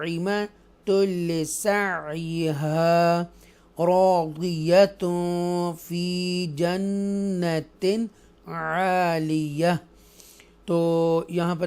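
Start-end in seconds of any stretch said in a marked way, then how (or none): 0.0-9.8 slow melodic tajweed chant; joined recitation. Shown as a demonstration. Teacher recites the joined reading for students, slow melodic chant. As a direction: flow the words together slowly. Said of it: "ये आगे मिला के पढ़ेंगे".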